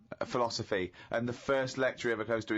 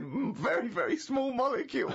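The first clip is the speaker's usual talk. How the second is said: mocking quote; 13 semitones higher than usual. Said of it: "and you said".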